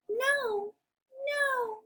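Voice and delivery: very high, squeaky little pig; high-pitched piggy voice. Acted. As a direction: squeal a refusal as a tiny pig. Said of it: "the two little pigs both say".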